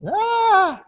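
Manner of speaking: a sudden high mock scream of fright; short high acted shriek; imitated scream. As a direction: yelp like a startled fisherman. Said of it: "these grown men even screamed out".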